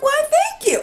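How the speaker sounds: high, airy mock-polite thank you; prim thank you. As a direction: play it dainty and high. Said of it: "I literally said".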